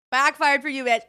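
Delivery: high, loud taunt; gloating retort. Retold or imitated. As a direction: throw it back at the hexer triumphantly.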